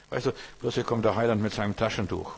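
ordinary talk, nothing marked

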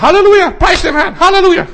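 high, loud sing-song Halleluja; gushing pious sister. Retold or imitated. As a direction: play the over-eager Pentecostal sister. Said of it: "die hat immer". (hohe, laute, schwärmerische Schwester)

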